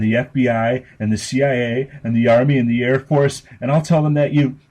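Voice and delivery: high, loud, building bratty threat; shrill child's threat. Acted. Little sister threatening her headless brother, building high and loud. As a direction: ramp up the list like a spoiled kid.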